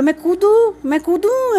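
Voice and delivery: imitating a daughter teasing from the wall, pitched well up; sing-song dare, high and playful; cheeky child threat. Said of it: "वहाँ से बोलो".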